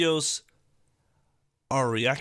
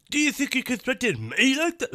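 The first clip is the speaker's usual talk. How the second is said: high, mocking parody of a threat; sneering imitation of the troll, pitched higher; mocking the tough guy; 11 semitones higher than usual. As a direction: ham up the clip's threat in a higher voice. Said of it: "Who the fuck talks like that?".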